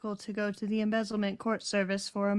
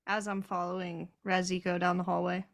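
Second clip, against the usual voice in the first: ordinary talk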